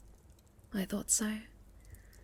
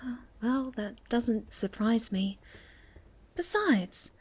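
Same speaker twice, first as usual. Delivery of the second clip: ordinary delivery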